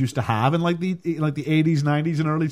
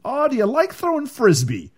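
high, eager mock small talk; awkward stepdad; 11 semitones higher, 3 dB louder than usual. Stepdad's forced small talk with stepkid, mock eager. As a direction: play the awkward stepdad fishing for chat. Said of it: "there were conversations like".

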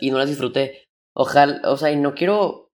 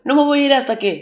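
mock little sister; 10 semitones higher, 3 dB louder, faster than usual.

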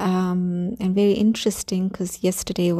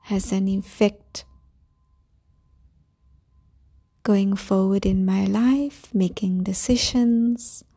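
calm grounding exercise. Acted. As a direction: guide them gently into calm. Leads a grounding meditation.

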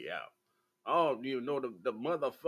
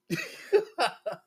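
high laugh. Real, not acted. Laughing at own joke.

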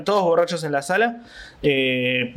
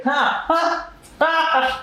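mock laugh; 13 semitones higher, much slower than usual. Mimicking girlfriend's laugh.

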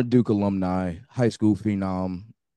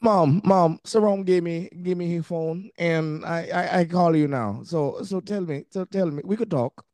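put-on Jamaican accent; 6 semitones higher than usual. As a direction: imitate a homesick Jamaican girl calling home. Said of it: "my Jamaican accent is not better than Sarome's".

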